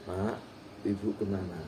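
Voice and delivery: low, quiet, courteous question; soft polite manner. Acted. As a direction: ask softly and respectfully. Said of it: "caranya halus". (bertanya halus, suara rendah dan pelan)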